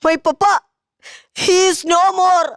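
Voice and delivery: slow, drawn-out hammed-up mourning; milk the sorrow, very slowly; mock grief. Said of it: "ഇങ്ങനൊക്കെ കരയണോ". (പതിയെ, നാടകീയമായ കള്ളക്കരച്ചിൽ)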